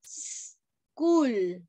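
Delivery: "School" with a long sss set apart from "kool"; isolated S sound. Shown as a demonstration. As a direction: split "School" into sss... cool. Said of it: "¿Suena como una vocal".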